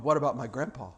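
low, soft question; subdued objection. Retold or imitated. Somebody questioning the promised miracle, subdued. Imagined objector, first asking.

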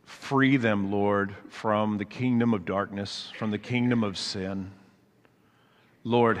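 soft, earnest pastoral prayer. Acted. Pastor praying over the congregation, soft and earnest. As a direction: pray it quietly, with gentle conviction.

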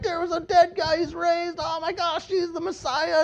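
very high, amazed outcry; excited screaming. Acted. Townspeople seeing the Messiah arrive, mock excited scream. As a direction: spread astonishing news in a high-pitched voice. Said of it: "There's people screaming".

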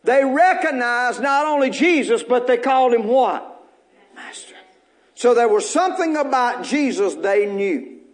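normal talking voice

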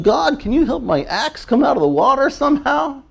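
mock the small-minded plea; whiny petitioner. Preacher mimicking a man begging God for his axe. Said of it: "here's this guy going".

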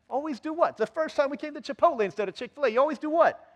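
high, incredulous retort; indignant parent. Acted. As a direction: challenge the accusation as an exasperated parent. Acts out parent's indignant reply.

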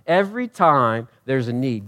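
ordinary delivery

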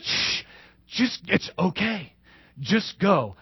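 soft, low soothing; tender hush. Acted. King gently hushing the forgiving servant, soft and low. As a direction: hush him gently, let him go.

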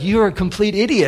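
scornful. Retold or imitated. Hotel lady's sigh voiced as a scornful put-down. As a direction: let the scorn drip off it. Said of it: "that sigh was like".

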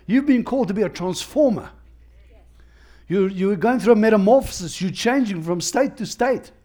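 fervent call to the congregation; preaching. Acted. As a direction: build it like a rousing sermon. Preaching at full force.

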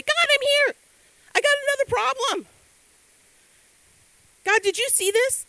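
high, loud, breathless excitement; a child running excitedly into God's throne room; eager rush. Retold or imitated. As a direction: burst in full of news.

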